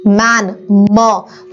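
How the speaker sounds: "मैन" slowly, then a long-held "म"; first sound isolated. Shown as a demonstration. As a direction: draw out the opening sound of "मैन" as "म", low. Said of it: "पहला sound निकल के आता है म".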